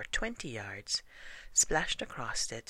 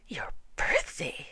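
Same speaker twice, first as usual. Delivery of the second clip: high, astonished question; great surprise; 10 semitones higher, 4 dB louder than usual. A narrator's reading. Pooh learns of Eeyore's birthday, greatly surprised. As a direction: exclaim it, voice leaping up. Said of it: "said Pooh in great surprise".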